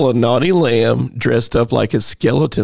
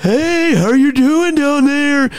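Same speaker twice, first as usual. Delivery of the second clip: very high, slow giraffe greeting; slower than usual. Pretend. Performing on request, giraffe greets cow far below. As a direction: stretch it out high from way up top. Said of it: "Do it".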